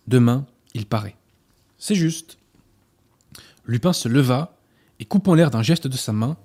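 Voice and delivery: reads the novel aloud with lively dialogue; story reading. A narrator's reading. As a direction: tell the duel scene like a storyteller. Reads a Lupin excerpt aloud. (lecture expressive d'un extrait de roman)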